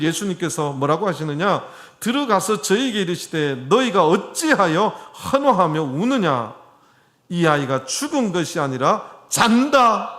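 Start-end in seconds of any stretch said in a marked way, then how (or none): 2.0-10.2 loud, raised proclamation of a verse; forceful scripture reading. Acted. Jesus addressing the wailing mourners, proclaimed loudly by a preacher.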